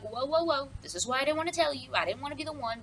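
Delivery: high, hurried mock protest; defensive. Guard defends himself to the king, mock defensive.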